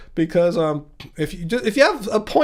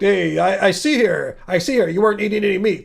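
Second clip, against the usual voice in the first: ordinary talk, nothing marked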